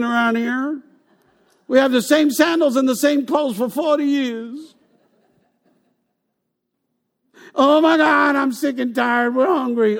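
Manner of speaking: high, loud diva whine; spoiled complainer. Retold or imitated. Israelites complaining in desert.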